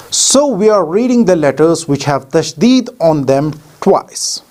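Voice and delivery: normal talking voice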